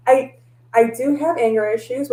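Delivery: usual speaking voice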